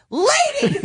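high-pitched, exasperated "Lady!"; mock exasperated call. Retelling reaction to a speakerphone talker, mock exasperated.

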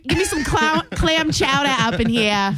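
mock Bostonian order; hammed-up Boston accent. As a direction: demand it like a stock Bostonian. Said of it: "the Boston one is so annoying".

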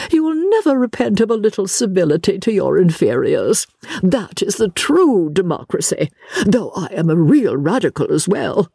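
high, theatrical lady lecturing a younger companion; effusive, opinionated lady. Acted. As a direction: sing out the greeting, then advise grandly.

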